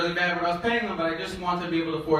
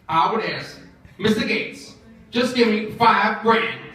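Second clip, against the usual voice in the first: slow, emphatic character voice; coworker's grand answer; 4 semitones higher, 5 dB louder, slower than usual. Acted. Coworker names her wish to Bill Gates, slow emphatic voice. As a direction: draw it out louder, as a weighty request. Acts out Bernice's solemn answer.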